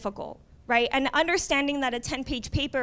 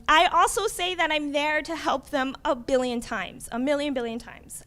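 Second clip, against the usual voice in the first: ordinary talk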